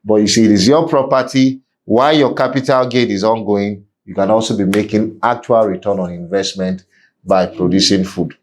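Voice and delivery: ordinary delivery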